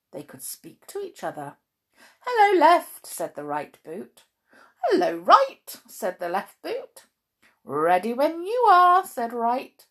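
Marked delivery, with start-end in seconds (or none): 2.2-3.1 high, loud little boot voice; squeaky boot voice. Acted. Boots talking, high voice. 4.8-5.8 high, loud little boot voice; squeaky boot voice. Acted. Boots talking, high voice. 7.6-9.0 high, loud little boot voice; squeaky boot voice. Acted. Boots talking, high voice.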